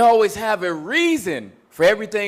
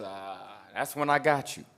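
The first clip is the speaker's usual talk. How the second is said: sly gotcha; 11 semitones lower, 10 dB quieter than usual. Retold or imitated. Enemy springs trap.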